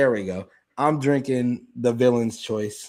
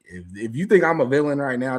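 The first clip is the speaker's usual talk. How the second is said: ordinary talk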